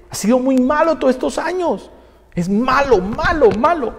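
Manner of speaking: high-pitched whining churchgoer; mock indignant complaint. Acts out member complaining about pastor.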